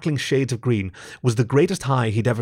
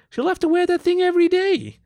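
shopkeeper voice; 4 dB louder than usual. A narrator's reading.

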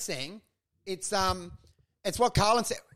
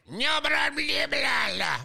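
higher-pitched nonsense babble; mock gobbledygook; 6 semitones higher, slower than usual. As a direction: imitate garbled foreign speech. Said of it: "It's gobbledygook".

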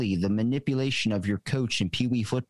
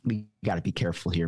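ordinary talk

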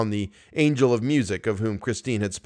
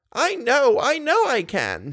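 put-on high, merry old lady; happy laugh; 14 semitones higher, slower than usual. A narrator's reading. Old lady laughs happily at Raoul, high. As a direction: chuckle it out fondly. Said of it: "she said with a happy laugh".

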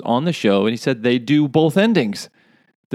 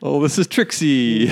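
proud pitchman; 3 semitones higher than usual. Pretend. Mock pitch presenting daughter.

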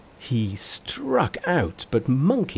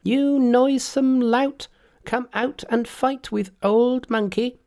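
Monkey's challenge cry; 13 semitones higher than usual. Acted. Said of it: "standing at the entrance he cried".

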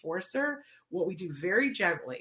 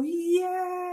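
much higher, louder "Yeah"; squealed praise; excited cheer; 12 semitones higher, 4 dB louder than usual. Shown as a demonstration. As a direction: cheer the child on with delight. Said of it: "they touch car and you go".

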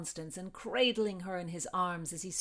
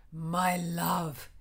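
ardent lover; 3 semitones lower than usual. A narrator's reading. Lover greets heroine.

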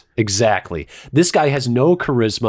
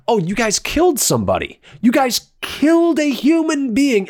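mocking the movie's moralizing; 9 semitones higher than usual. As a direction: sneer at the film's hand-wringing.

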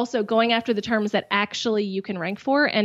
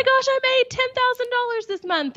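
high, gushing excited voice; excited hype; 13 semitones higher than usual. Acted. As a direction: sell it like a hype clip. Acted hype clip intro.

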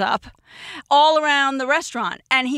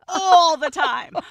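ALL stretched out high and loud on "all the time"; emphatic complaint; 6 semitones higher, 5 dB louder, slower than usual. Real, not acted. Complaining she was made to cry.

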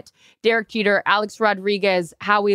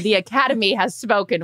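usual speaking voice

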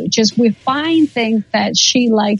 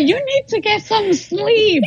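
much higher mock-mom voice, a teasing scold; imitating mama; 10 semitones higher than usual. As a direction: play her mother nagging her, pitched way up. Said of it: "My mama will say".

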